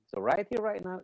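ordinary delivery

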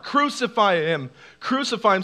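ordinary talk, nothing marked

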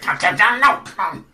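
frustrated goblin. Acted. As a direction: wave them on like a flustered goblin. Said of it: "He's like".

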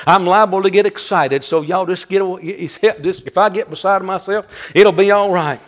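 ordinary delivery

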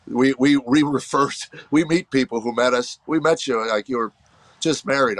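ordinary talk, nothing marked

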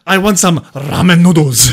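exaggerated Japanese accent, drawn out; mock samurai accent. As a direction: put on a slow, hammed-up Japanese samurai accent. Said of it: "like, the samurai chop with your hand".

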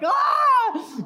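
high, loud yell while being carried off; child's scream. Retold or imitated. Boy carried out of church on a man's shoulder, loud scream. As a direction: wail as you're hauled away. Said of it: "memories of going".

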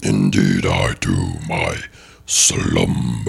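very low rumbling cave voice; grinding stone statue. Acted. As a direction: drone it from deep underground. Plays the Cave of Wonders.

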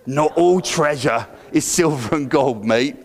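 lightly voiced pirate, said with the crowd; a group try at a swaggering pirate; group Jack Sparrow. Shown as a demonstration. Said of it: "let's all say that together in our best Jack Sparrow voice".

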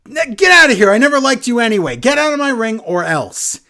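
high, loud mock tirade that eases slightly; aggravated. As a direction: act out a wrestler ordering someone out. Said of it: "Owens gets all aggravated".

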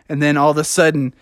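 ordinary delivery, nothing marked

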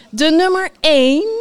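high, loud announcer build-up; grand announcement. A narrator's reading. Host reveals the winner to listeners, grand announcement.

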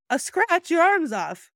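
high, incredulous retort, lightly acted; mock disbelief. Answering the knight who lost an arm, mock disbelief. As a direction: imitate an astonished opponent. Acting out knight scene argument.

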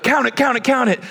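high-pitched chanting urgency; egging on. Retold or imitated. YouTuber pressing the man to count bills, high excited urging. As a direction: imitate a hype man pushing for a reaction. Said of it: "trying to get me worked up".

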